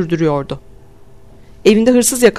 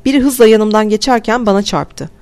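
ordinary delivery

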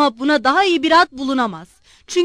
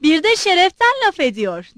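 higher, louder indignant cry; shouted; 6 semitones higher, 6 dB louder than usual. A narrator's reading. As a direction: yell it in outrage. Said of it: "diye bağırdı".

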